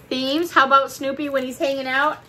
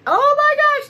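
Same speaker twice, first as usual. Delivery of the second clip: high, loud thrilled squeal of delight; excited gasp; 8 dB louder than usual. Real, not acted. Unboxing a gift from a friend, squealing with delight.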